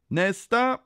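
high, loud summons with a rising end; called out. A narrator's reading. Kontrollanten ropar till kön, called out. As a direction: call the next one up. Inspector calling the queue.

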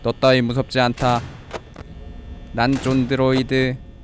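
low monotone android; robot voice. Pretend. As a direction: intone it like an emotionless machine. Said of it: "난 기계다".

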